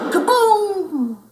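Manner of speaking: high, loud laughing kaboom; holler. Acted. Pup crashing home, laughing holler. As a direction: holler with glee. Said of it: "he hollered".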